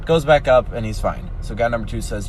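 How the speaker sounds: ordinary talk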